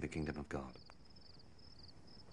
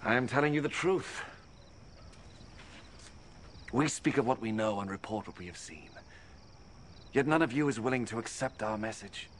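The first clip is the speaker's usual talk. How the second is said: raised, higher, ringing declaration; forceful proclamation; 4 semitones higher than usual. Acted. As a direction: proclaim it like a rebuke to the whole crowd. Jesus rebukes the unbelieving teacher.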